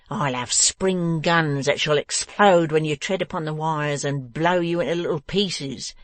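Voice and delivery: slow, menacing threats; angry cry. A narrator's reading. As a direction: threaten with relish, building to a loud dismissal. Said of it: "cried the dwarf".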